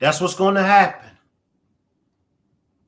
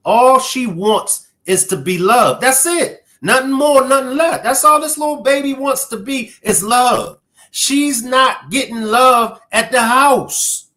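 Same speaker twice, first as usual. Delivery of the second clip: higher-pitched, emphatic pleading; impassioned plea; 4 semitones higher, 3 dB louder than usual. Real, not acted.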